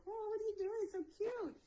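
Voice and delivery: put-on high, sweet baby talk to a pet; cooing at cat. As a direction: coo at the cat like it's adorable. Cooing at cat under porch.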